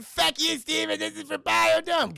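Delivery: hammed-up scream at the end of the plane ride; mock screaming. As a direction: scream it as you dive the plane.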